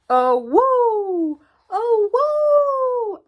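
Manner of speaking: loud, rising howl at the moon; wolf howl. Acted. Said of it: "the wolf howls at the moon".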